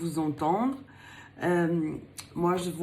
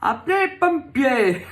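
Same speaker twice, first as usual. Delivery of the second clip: higher and louder, a playful mock alarm; 7 semitones higher, 9 dB louder than usual. Tarot reader teasing her client about a hot first date, a playful mock alarm. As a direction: cry out as if the romance is on fire. Card reveals a hot meeting.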